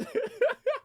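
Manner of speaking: very high squeal of laughter; shrieking laugh. Real, not acted. Streamer howling at the controller toss, shrieking laugh. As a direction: let out a piercing laugh.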